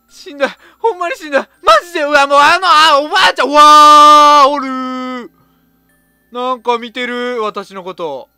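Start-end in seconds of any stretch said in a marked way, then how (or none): 3.0-5.3 loud scared cry; frightened cry. Real, not acted. Spots scary grandma doll.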